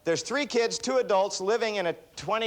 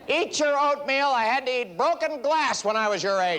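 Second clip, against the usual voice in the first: scolding relative; 8 semitones higher than usual. Retold or imitated. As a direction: lecture the kid like a put-upon elder.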